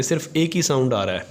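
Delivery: ordinary talk, nothing marked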